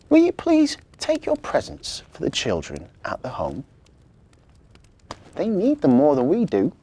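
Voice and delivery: put-on child voice. Victoria's voice in story.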